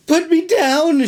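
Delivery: very high panicked wail; frantic high cry. Acted. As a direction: scream to be put down. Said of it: "she wailed".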